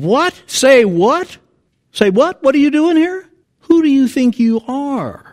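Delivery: high, loud, incredulous challenge; indignant outburst. Acted. Preacher acting out what indignant means, indignant and loud. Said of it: "You're mad. You're saying".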